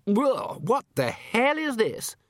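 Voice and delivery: anecdote breaking into high-pitched disgust; drawn-out, horrified exclamation; appalled party guest. Acted. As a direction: pitch leaps up in outrage at a mouthful.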